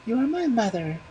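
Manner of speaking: put-on very high baby voice; a child naming his bones. A narrator's reading. Baby naming the magic bones, very high.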